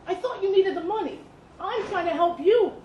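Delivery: desperate, almost begging; voice breaking with need. A narrator's reading. Father pleading with Dakota to take the fight, voice cracking. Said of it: "His voice broke with a rough edge of need".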